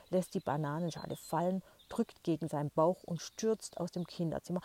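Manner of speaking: plain reading voice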